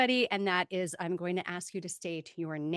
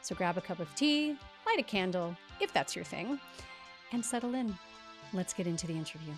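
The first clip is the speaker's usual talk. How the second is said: polished scripted host read; podcast intro read; 5 dB quieter than usual. Acted. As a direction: read the intro like a show opener. Scripted podcast intro over music.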